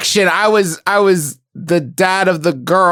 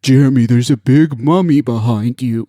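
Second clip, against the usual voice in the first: mock spooky line; 8 semitones lower than usual. Improvising Stephen King dialogue.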